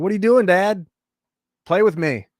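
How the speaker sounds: put-on kid voice. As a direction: whine like a kid wanting attention. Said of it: "kids are gonna be like".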